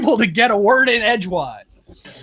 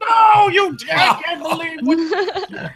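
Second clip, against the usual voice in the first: mock outraged teacher; 10 semitones higher, slower than usual.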